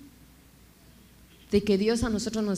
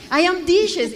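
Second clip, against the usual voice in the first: a louder, higher insistence on the wrong phrase; insisting loudly; 9 semitones higher, 9 dB louder, slower than usual. Retold or imitated. To her adoptive family, repeating her mistake, loud and insistent. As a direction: push the broken English harder so they understand.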